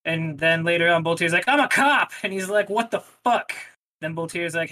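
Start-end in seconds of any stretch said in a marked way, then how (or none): 1.5-2.1 high, loud proud declaration; loud blurt. Retold or imitated. Boltier blurts out a reveal. 2.7-3.5 shocked. Retold or imitated. Guy reacts to cop reveal.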